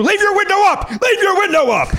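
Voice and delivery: yelling. Retold or imitated. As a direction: bark it like a parking attendant. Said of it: "one guy yells at you".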